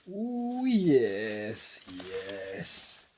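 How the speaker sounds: ordinary talk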